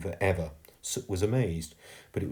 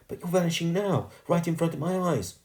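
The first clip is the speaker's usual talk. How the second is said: put-on high, urgent girl's voice; distressed protest; 10 semitones higher than usual. A narrator's reading. Girl watching ghost vanish, distressed protest. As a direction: protest as he slips away.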